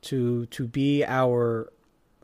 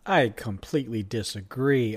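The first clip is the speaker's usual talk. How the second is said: ordinary talk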